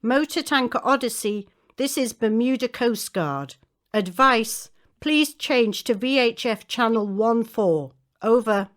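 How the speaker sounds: marine radio. Acted. Coast guard calls tanker by radio.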